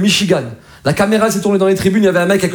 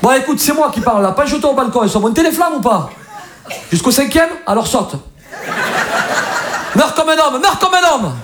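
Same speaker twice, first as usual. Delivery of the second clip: firefighter on the phone; 6 semitones higher than usual. Acted. Stand-up bit voicing Marseille firefighter.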